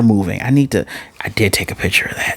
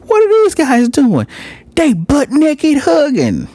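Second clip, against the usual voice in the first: much higher, squeaky gossiping bystander; high, mocking onlooker voice. An anime viewer mimicking a shocked bystander, high squeaky gossip. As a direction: shriek it like a shocked classmate spotting them. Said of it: "you hear someone goes".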